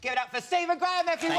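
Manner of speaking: rally the crowd to applaud; host's applause call. Real, not acted. Host getting the audience to cheer the guest, fast showman call.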